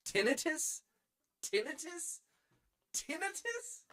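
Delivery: "Tinnitus?" as TIN-uh-tus, pitched very high and rising; incredulous first-syllable stress. Shown as a demonstration. Mocking the other pronunciation.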